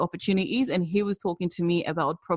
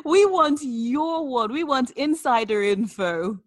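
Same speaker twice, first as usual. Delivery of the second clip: slow, higher-pitched mock mom insistence; playful mommy voice; 6 semitones higher, slower than usual. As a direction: spell it out slowly like a mother. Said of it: "use my mommy voice".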